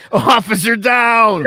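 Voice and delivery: high, loud, drawn-out mock distress call; hammed-up emergency shout; officer calling for backup. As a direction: holler for help like a cop under fire. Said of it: "I tend to walk the beat alone".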